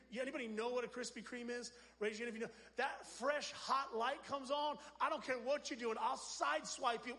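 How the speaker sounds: normal talking voice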